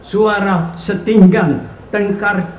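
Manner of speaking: impassioned poetry reading, swelling and falling; poetry recitation. Acted. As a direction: declaim the poem in measured phrases with dramatic pauses. Recites a poem aloud. (deklamasi puisi yang dramatik)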